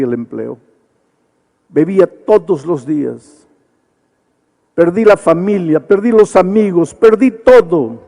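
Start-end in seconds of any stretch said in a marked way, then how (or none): 1.7-8.0 growing to an impassioned loud lament; building anguish. Retold or imitated. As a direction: let the drunk's confession swell into a cry. Drunk's story rising to anguish.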